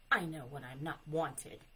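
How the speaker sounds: a touch of wounded huff; huffy. A narrator's reading. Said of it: "turned back with a huffy sniff".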